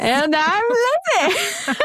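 high squeaky mock host voice; game show host. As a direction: tease her with a squeaky host intro. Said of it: "like a game show host".

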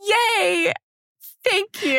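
high, awkward little thank-you; self-mocking, high-pitched awkward gratitude. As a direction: act out an embarrassed reply to a fan. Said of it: "I'm like ... I am awkward".

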